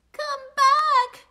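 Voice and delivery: very high, loud call; full-voiced, high-pitched storybook shout; desperate shout. A narrator's reading. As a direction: yell after something getting away. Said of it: "shouted Cyril".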